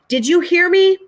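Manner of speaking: angry yell. Acted. Angry father yelling at daughter.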